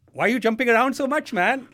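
high, loud Indian uncle voice; Indian uncle accent. Retold or imitated. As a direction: scold like an exasperated Indian uncle. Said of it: "So the Indian uncle would be like".

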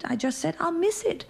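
put-on high whiny child; childish protest. A girl correcting her mother in a high, whiny child voice.

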